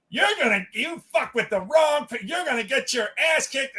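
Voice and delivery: imitated high mouthy woman; annoying girl voice. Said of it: "she's doing her annoying voice".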